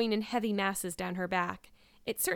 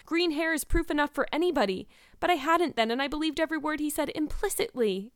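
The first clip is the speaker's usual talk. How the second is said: put-on higher-pitched, earnest girl justifying herself; indignant girl; 6 semitones higher, 4 dB louder than usual. A narrator's reading. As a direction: argue your innocence with dramatic conviction.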